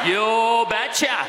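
slow, hammed-up Norwegian accent; imitated Norwegian dad, drawn out; thick Norwegian accent. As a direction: stretch it out like a Norwegian father-in-law. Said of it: "responded in his thick Norwegian accent".

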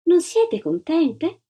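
slow, high, insincerely kind question; syrupy, lilting condescension; falsely sweet lady. A narrator's reading. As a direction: ask it slowly through an unkind smile. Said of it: "non era un sorriso simpatico".

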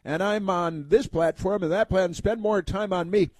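high-pitched imitation of a shameless plugger; mock self-promoter. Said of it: "spend their whole life self-promoting".

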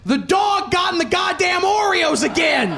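high, loud exasperated dad; mock-furious dad shouting at his son; dad yelling. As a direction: shout like a fed-up father blaming the dog. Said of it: "He goes".

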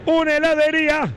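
excited. Real, not acted. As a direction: announce it like you just found treasure.

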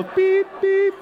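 high laughing beep beep; laughing high scanner beeps. Retold or imitated. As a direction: chirp like a scanner.

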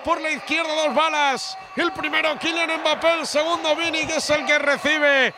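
chanting, full-voiced radio commentary; triumphant Spanish football call; goal recap commentary. Real, not acted. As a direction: declaim each phrase on a high held note, then drop.

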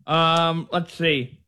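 talking around a pen; words muffled by pen in teeth; pen in mouth. Real, not acted. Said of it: "Sorry, I got my pen in my mouth".

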